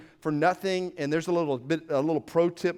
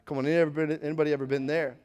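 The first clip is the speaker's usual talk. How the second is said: usual speaking voice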